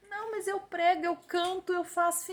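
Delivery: mock excuse. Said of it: "quoting hypocrites' excuses".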